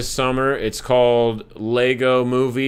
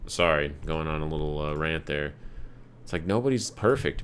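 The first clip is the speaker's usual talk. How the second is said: ordinary talk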